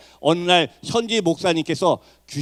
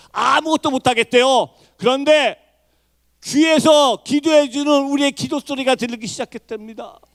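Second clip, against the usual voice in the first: high, raised testimony with ringing phrase ends; preaching at full force; 6 semitones higher, 4 dB louder than usual. Real, not acted. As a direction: proclaim the turning point fervently. (고조된 간증 설교)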